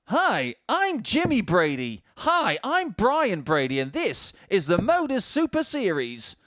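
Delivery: lightly voiced sitcom family intro; a hint of a chirpy sitcom greeting; cheesy TV intro. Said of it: "like the Brady Bunch all over again".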